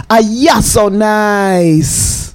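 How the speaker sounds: drawn-out slow slogan read; crooned station slogan, fast then slowed; radio slogan. Shown as a demonstration. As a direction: stretch out the jingle line. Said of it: "you know the slogan".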